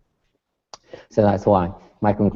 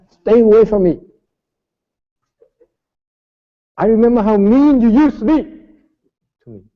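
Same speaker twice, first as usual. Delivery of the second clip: mock angry shout; 11 semitones higher, 6 dB louder than usual. Student snapping back at master.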